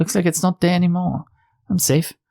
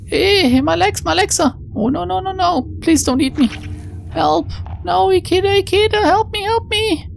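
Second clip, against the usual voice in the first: very high, shrill-edged terrified cries; panicked screaming. Acted. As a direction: scream for Ikeda as it eats you. Being eaten, screams for Ikeda.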